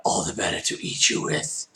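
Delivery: low, husky wolf voice; menacing wolf. Acted. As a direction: rasp it low, about to pounce. Wolf reveals himself.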